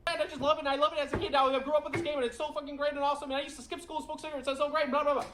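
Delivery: very high, fast breathless gushing; mocking impression. Mocking a gamer's breathless gushing, very high and fast. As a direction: gush without stopping for breath. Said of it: "How can anybody listen to this guy?".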